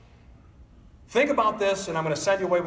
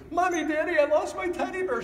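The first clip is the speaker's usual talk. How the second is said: put-on high child's voice calling for mom and dad; upset little kid; 12 semitones higher than usual. Four-year-old runs up to parents, high upset child. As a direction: play a four-year-old with a lost toy. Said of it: "your little four-year-old comes running up to you".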